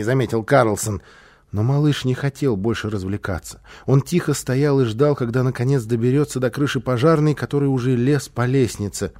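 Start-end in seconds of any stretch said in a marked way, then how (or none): none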